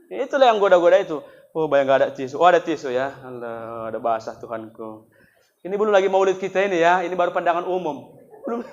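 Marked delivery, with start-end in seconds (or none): none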